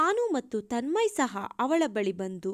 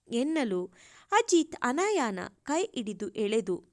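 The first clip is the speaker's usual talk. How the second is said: ordinary delivery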